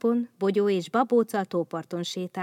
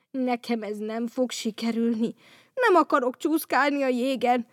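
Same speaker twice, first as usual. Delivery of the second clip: a touch higher, whiny complaint; whining; 4 semitones higher, 3 dB louder than usual. Acted. Vendel giving up on skating, mild whining. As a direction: whimper that you give up. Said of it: "siránkozott Vendel".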